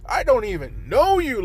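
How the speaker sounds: high, loud flustered yelps of hey; panicking, trying to back away; high startled babbling; panicked protest. Acted.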